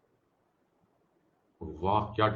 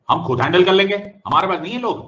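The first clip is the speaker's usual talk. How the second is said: louder, higher defiant insistence; state insisting; 7 semitones higher, 9 dB louder than usual. Retold or imitated. State refusing help from the union, louder and higher. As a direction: push back hard on the union.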